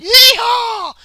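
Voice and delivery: whooping high cowboy holler; rowdy yeehaw. Acted. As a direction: yell it like you're joining the chase. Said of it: "other men are like".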